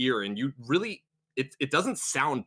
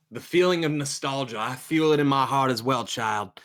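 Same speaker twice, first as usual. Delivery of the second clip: raised, emphatic sermon voice; consoling preacher; 3 semitones higher, 3 dB louder than usual. Acted. Preacher answering a nostalgic follower, fervently assuring. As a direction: promise it like a preacher to his flock.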